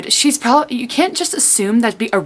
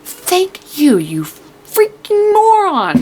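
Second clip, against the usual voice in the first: high, drawn-out exasperated outburst; 9 semitones higher, 3 dB louder, much slower than usual. Retold or imitated. Reader to Day once he finally realizes June was right, exasperated. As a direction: stretch the insult out, fed up. Relieved insult to character.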